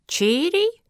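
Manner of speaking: high, rising, disbelieving question; incredulous question. A narrator's reading. Turtle mishearing a guest's name, incredulous. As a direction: shoot up high on a baffled query.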